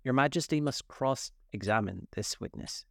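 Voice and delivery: usual speaking voice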